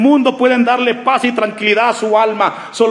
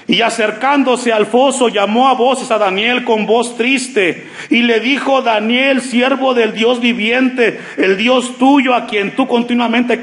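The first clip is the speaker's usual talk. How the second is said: slightly raised, measured Bible reading; scripture reading; 4 semitones higher than usual. Acted. Pastor reading Daniel to the church, measured and raised. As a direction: read the verse out to the congregation, a touch raised.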